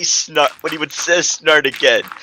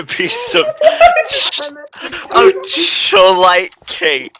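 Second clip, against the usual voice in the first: giggling while reading the words; fighting back laughter; laughing through it; much slower than usual. Real, not acted. Narrator cracking up mid-sentence, laughing.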